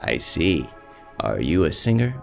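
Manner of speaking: ordinary delivery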